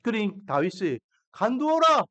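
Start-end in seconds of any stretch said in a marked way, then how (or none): 1.3-2.0 firm command. Retold or imitated. David ordering Abishai to stand down, firm raised command. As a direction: halt him sharply with 간두어라, commanding.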